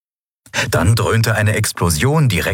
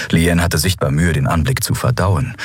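ordinary delivery

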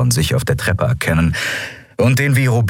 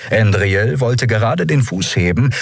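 ordinary delivery

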